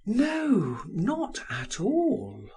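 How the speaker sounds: slow, measured "not at all"; a wife answering slowly and evenly; slow reply. A narrator's reading. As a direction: draw out the denial. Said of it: "replied slowly".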